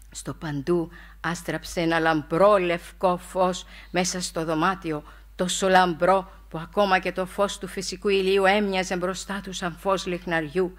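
normal talking voice

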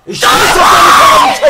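loud, high, stretched-out roar; raging shout. Real, not acted. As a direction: roar it in gamer rage. Rage at conceding in game.

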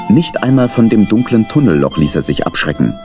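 ordinary talk

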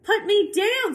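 high, loud, panicked squeal; panicked fish. Acted. The fish begs the cat, a high panicked squeal. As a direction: cry out to be put down. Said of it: "said the fish".

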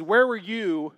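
insistent, lightly acted divine questioning; God challenging. God questioning Job about creation, insistent challenge. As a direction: hammer each repeated question home. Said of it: "He says".

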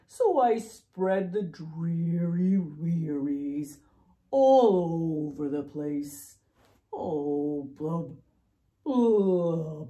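drawn-out dreary chant, falling away; gloomy storybook fish refrain; glum pout-pout fish. Acted. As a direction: moan it with stretched vowels, then bubbly blubs. Voices the fish's glum refrain.

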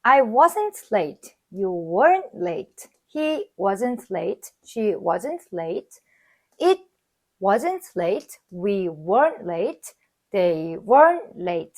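very slow, each word spaced out; slow drill reading. Shown as a demonstration. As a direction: read it slowly for learners to repeat. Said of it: "부정문에 대해서 연습을 해볼까요". (천천히 또박또박 읽는 시범)

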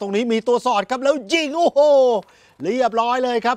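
high, loud goal shout, real excitement; excited goal call. Real, not acted. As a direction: erupt as the shot goes in.

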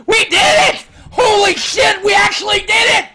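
shrieking, laughing celebration; shrieking jackpot winner. Real, not acted. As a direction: scream with joy like you won. Celebrating victory, shrieking with joy.

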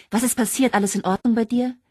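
a friend fearing bad news; alarmed worry. Sharon alarmed on phone.

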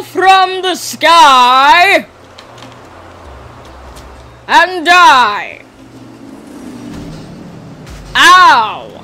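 loud, high tyrant proclamation; megalomaniac rant. Pretend. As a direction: threaten them from on high. Goat villain shouting.

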